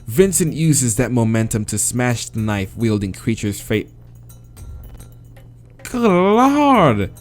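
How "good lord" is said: ordinary talk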